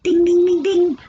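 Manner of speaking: loud, high bell-like dings; teacher rewarding the right answer with dings; game show ding. Pretend. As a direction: ring out like a quiz-show bell.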